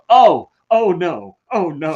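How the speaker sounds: loud, drawn-out horrified viewer; hammed-up horror at what's on screen; mock dismay. A viewer watching The Boys go too far, mock dismay. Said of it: "and then you go".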